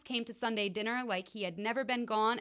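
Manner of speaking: usual speaking voice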